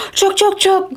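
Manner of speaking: very high squeaky chook calls; squirrel chatter. Acted. Mama Squirrel scolding the grizzly in very high chatter. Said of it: "said one hundred more Mama Squirrels".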